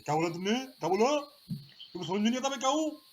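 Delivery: imitating the math teacher yelling at a student he thinks insulted him; angry scolding. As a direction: bark it at a cheeky student. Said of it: "इस तरह डांटते थे वो".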